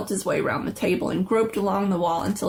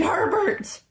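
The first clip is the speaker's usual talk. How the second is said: scream; 12 semitones higher than usual. A narrator's reading. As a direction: scream his name toward the door. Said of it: "she screamed".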